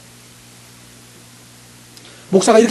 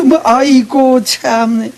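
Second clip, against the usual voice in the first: exasperated sigh; 3 semitones higher, much slower than usual. Retold or imitated. Mock sigh at the pastor.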